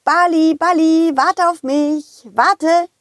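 calling after the ball, high. Acted. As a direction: call out to him eagerly.

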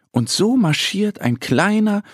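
usual speaking voice

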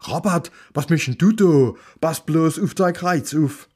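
höher und lauter, gehetzt; außer Atem hereinplatzend; abgehetzte Ehefrau. Acted. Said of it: "eine Frau stürmte abgehetzt herein". (Ehefrau ruft ihrem Mann besorgt zu, abgehetzt)

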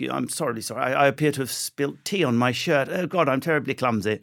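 put-on apologetic upper-class English accent; Hugh Grant. Comedian apologising for spilt tea, Hugh Grant-style English accent. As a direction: do a bumbling, well-spoken English accent. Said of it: "If you sound like Hugh Grant".

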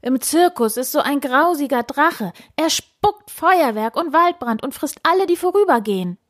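high-pitched, panicky lament; wailing. A narrator's reading. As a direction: wail out the terrifying news. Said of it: "heulte der Ritter". (hoch und weinerlich, voller Angst)

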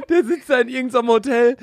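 laughing. Real, not acted. Keeps laughing through the story.

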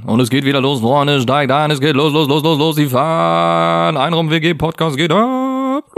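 slow, stretched crier's call; a fair crier opening the podcast ride; fairground barker. Pretend. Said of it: "Ich wär ein Rekommandeur".

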